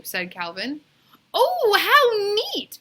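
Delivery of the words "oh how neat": put-on high, loud, slow gushing girl voice; excited exclaim. Mauricia gushing over the tattoo, high and slow. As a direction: gush with delight. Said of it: "exclaimed Mauricia".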